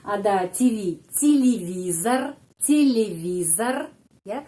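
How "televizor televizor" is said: slow demo. Shown as a demonstration. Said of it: "Orang Indonesia bilang T V".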